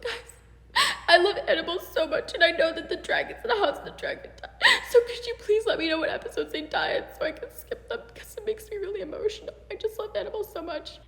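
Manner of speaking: high, squeaky fake-crying plea; mock tearful fan. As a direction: imitate an overemotional poster, high and weepy. Mocks an overemotional online post.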